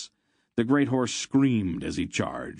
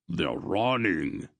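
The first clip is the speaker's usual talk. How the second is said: slow called-out report; Barak's shout; slower than usual. A narrator's reading. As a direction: call it from the edge. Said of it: "Barak shouted".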